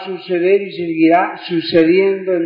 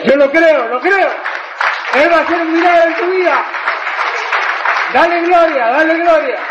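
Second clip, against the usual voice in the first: fervent preaching; 9 semitones higher, faster than usual. Real, not acted. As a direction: build into a rousing call for praise. Preacher climaxes sermon.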